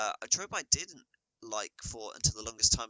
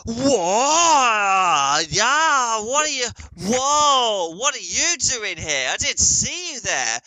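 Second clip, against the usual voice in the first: high, loud, slow startled yelling; mocking anime reaction to a newcomer, shouted whoa; overdramatic anime shock; 13 semitones higher, 10 dB louder, much slower than usual. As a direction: ham up the shock of someone appearing. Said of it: "you don't go".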